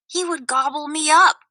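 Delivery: high, frightened lizard cry. A narrator's reading. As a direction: cry out in fear. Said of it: "Lizard cried and darted away".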